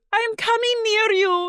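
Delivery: march forward like a defiant Whitney; mock Whitney. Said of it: "And Whitney goes".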